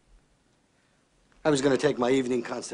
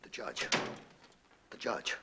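very soft, falling words; hushed thinking aloud; pondering murmur; 3 semitones lower, 13 dB quieter than usual. Acted. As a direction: say it quietly to yourself as an idea forms. Boss thinks aloud after outburst.

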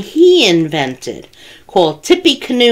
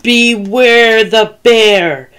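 higher, slow growly call at its peak; drawn-out warning, right on top of them; growly bear, very close; 4 semitones higher, 4 dB louder, slower than usual. A narrator's reading. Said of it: "And closer".

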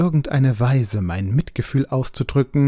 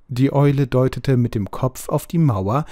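ordinary delivery

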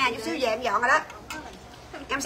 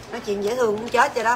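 ordinary delivery

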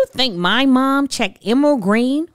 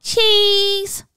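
classmates saying cheese; 9 semitones higher than usual. Retold or imitated. Everyone say.

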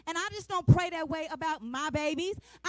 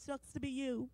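mock sympathy; 4 semitones lower, 9 dB quieter than usual. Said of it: "I'm like".

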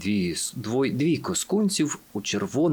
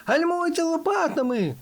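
very high, panicked cry; terrified yell. A narrator's reading. Fenek telling friends to brake with paddles, terrified. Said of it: "нажахано волав Фенек".

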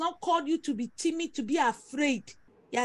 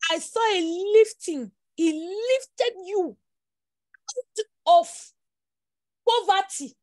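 rising, slow, louder preaching of a vision; prophetic declaration; 4 semitones higher, 5 dB louder, slower than usual. Acted. Prophet to congregant, vision of her lifting, declared slow and loud.

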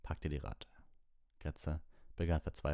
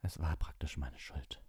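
croaked. Acted. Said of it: "krächzte er".

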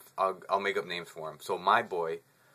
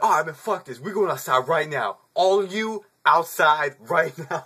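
higher, louder call to fight; loud challenge; 6 semitones higher, 6 dB louder than usual. Retold or imitated. K daring the whole group to step outside. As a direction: shout them outside. K calls them all outside.

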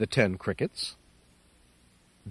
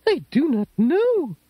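put-on high quavery old lady, a bit louder; 13 semitones higher, 3 dB louder than usual. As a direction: invite him in as a tearful old lady.

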